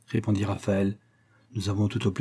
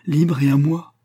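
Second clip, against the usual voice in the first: higher, louder, rising question; excited realisation; 5 semitones higher, 5 dB louder than usual. A narrator's reading. Pauline learning he is free and hers, excited. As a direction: lift it into an eager rising question. Joyful echo of his declaration.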